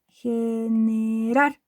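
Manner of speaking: Generar stretched slowly to a stressed rar; stress on last syllable. Shown as a demonstration. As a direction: draw out Generar, leaning on the final rar. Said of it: "esa es la tónica".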